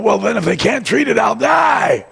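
high, loud shout of panic; panicked shout about dying; panicked outburst. Acted. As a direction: blurt out the fear at full voice. Frightened man blurts out fear.

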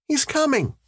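put-on very high child's voice; young boy. A narrator's reading. Son telling his father about his brother, boyish. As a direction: answer as a little boy.

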